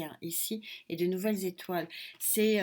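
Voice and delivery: normal talking voice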